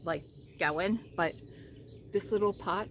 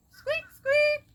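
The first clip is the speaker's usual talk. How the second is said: very high, loud squeaky voice; squeaky pet call; 12 dB louder than usual. Real, not acted. Owner calling pet for dinner, squeaky calling.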